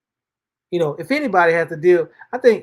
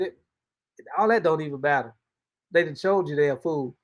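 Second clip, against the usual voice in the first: normal talking voice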